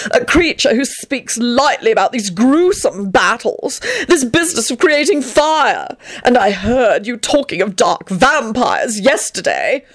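high, slow theatrical accusation; triumphant accusation. A narrator's reading. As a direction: list the crimes with haughty triumph. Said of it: "he added triumphantly".

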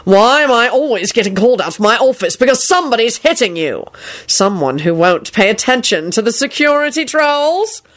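exasperated dressing-down; irate authority. A narrator's reading. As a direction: demand an explanation as the boss. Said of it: "He stared at Neville in a terrifying manner".

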